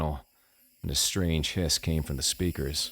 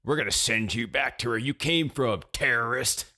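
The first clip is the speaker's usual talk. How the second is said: mock menacing stranger, pitched up; hostile truck driver; 6 semitones higher than usual. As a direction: imitate a bigot's threat in a higher voice. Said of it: "and he tells me".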